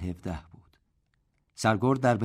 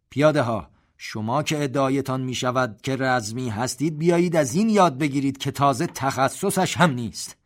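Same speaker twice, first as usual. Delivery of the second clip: loud, higher call to the infantry; commanding rebuke; 5 semitones higher, 6 dB louder than usual. A narrator's reading. General addresses the infantry battalions, loud and challenging.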